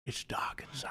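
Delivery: whispering. Acted. Documentary narration continues.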